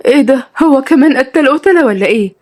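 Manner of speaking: high, alarmed question; shocked. Acted. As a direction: react aghast to dreadful news. Shocked to hear he kills.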